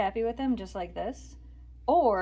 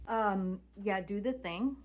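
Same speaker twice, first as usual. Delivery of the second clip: normal talking voice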